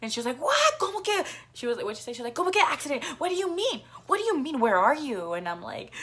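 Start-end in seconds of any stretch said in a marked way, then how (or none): 0.4-1.2 very high, sharp startled question; alarmed mom. Retold or imitated. Mom hearing of the accident, a very high alarmed cry. 2.3-5.3 high, rapid anxious questions; worried mom. Retold or imitated. Mom demanding details on the phone, high anxious questioning.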